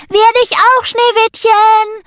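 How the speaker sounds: very high, loud crow, slowing on "Schneewittchen"; Sammy crowing a teasing goodbye. A narrator's reading. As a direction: crow it out cheekily. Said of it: "krähte Sammy".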